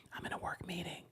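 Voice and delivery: soft unvoiced whisper. Shown as a demonstration. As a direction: whisper it like you're hiding at work.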